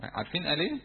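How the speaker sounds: ordinary talk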